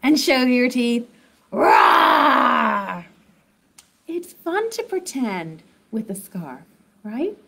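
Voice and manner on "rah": louder playful rah; hammed-up kid-friendly roar; mock tiger roar. Shown as a demonstration. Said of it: "make your terrible claws".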